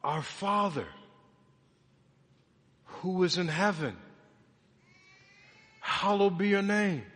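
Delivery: measured recitation with long pauses between phrases; proclaimed prayer. Acted. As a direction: declare each phrase clearly, letting it stand. Proclaims the same prayer lines again.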